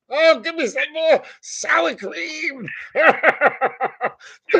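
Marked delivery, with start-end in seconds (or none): 0.1-3.0 high, slow mocking voice of a greedy Midwestern woman. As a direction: ham up a higher, slow plea for more sour cream. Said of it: "some fucking fat Miss Midwestern chick".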